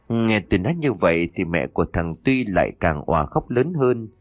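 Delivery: ordinary delivery, nothing marked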